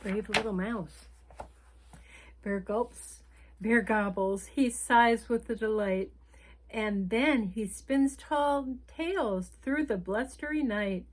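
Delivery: lively picture-book read-aloud; reading to children. A narrator's reading. Storyteller reading a picture book to children, lively. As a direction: read the story like bedtime for little ones.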